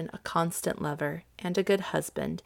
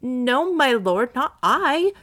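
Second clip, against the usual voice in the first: higher voice, climbing at the end; protesting denial; 5 semitones higher, 3 dB louder, slower than usual. Acted. Viola to the angry Duke, protesting denial. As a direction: plead innocence in a lifted voice.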